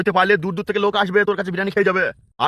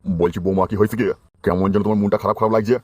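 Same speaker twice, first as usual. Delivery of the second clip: put-on much lower, gruff old man's voice; old father-in-law; 7 semitones lower than usual. Old father-in-law tells his daughter-in-law he won't help his son, in a low old voice.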